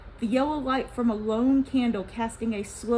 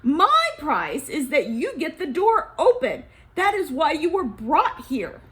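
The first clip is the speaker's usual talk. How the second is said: loud, angry demand from the president; rising anger; 4 semitones higher, 7 dB louder than usual. Acted. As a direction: press the price hard, growing angrier. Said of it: "his anger rising".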